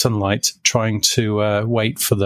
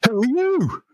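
much higher, insistent repeated questioning; demanding caller. Retold or imitated. Boss calling the office, demanding who answered, much higher. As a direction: bark the question down the phone at a stranger. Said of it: "And this voice went".

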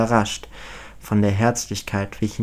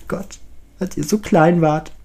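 a hint of a wistful mother, higher; wistful reminiscing; 7 semitones higher, 3 dB louder than usual. A narrator's reading. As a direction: nostalgic sigh of a mother. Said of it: "schwelgt sie in der Vergangenheit".

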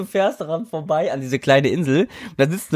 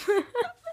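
squeaky high laugh; 9 dB quieter than usual. Real, not acted.

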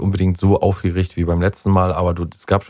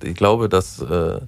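normal talking voice

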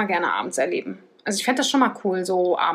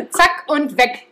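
laughing; 6 semitones higher, 8 dB louder, much slower than usual. Real, not acted. Joking about condom check.

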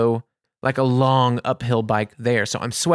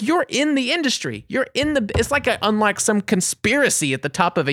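high, incredulous accusation; retold indignant rant; 8 semitones higher than usual. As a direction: re-enact berating someone who should know. Acted rant at the clerk.

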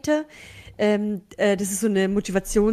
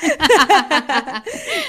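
long rising laugh; 6 semitones higher, 4 dB louder than usual. Real, not acted. Laughing at anesthesia joke. (langes Lachen)

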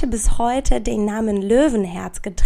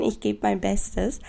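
normal talking voice

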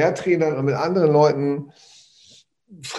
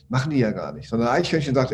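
usual speaking voice

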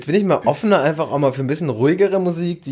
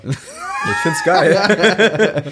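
excited shout; 7 semitones higher, 4 dB louder, much slower than usual. Real, not acted. Defends his song loudly.

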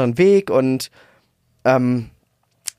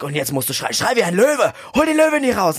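mock stage coach, climbing much higher to a rousing call; imitating coach's command; 5 semitones higher than usual. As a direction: hype the crowd up like a motivational speaker. (nachgemachter Motivationscoach, steigert sich zum Ruf)

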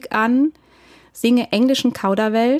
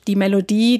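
normal talking voice

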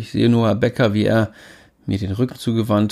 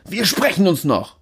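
threatening; 8 semitones higher than usual. Acted. Niklas threat.

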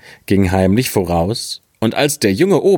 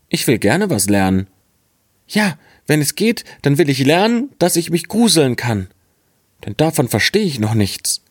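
put-on higher-pitched naive young lad; naive boy; 7 semitones higher than usual. Boy tells his father he wants to learn fear, higher naive voice. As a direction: pitch up into the simple, eager boy.

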